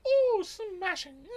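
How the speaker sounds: high, very slow posh drawl. Acted. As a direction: answer like a grand English gent. Answers in posh voice.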